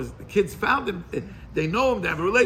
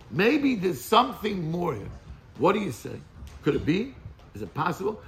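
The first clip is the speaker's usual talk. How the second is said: ordinary delivery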